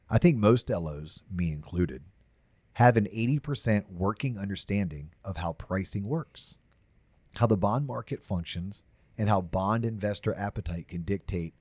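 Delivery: deep, smooth radio DJ voice. Shown as a demonstration. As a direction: read it like a late-night radio host. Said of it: "I'm gonna go with my radio D J voice".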